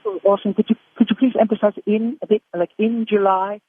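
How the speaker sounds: politely asking Orson to stress in; a hint of a polite British director; imitating the director. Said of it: "The guy says".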